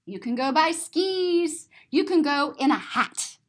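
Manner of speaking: usual speaking voice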